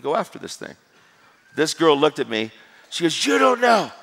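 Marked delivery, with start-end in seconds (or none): 3.3-3.9 high, loud mock-hysterical yell; imitated outburst. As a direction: lash out as the upset woman being quoted. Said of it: "she just lost it".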